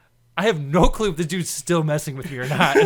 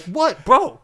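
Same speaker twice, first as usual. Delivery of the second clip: loud, high acted bewilderment; startled protest; 9 semitones higher, 7 dB louder than usual. Storyteller answering a yelling coworker, startled protest. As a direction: blurt it back in mock alarm. Startled reply to being yelled at.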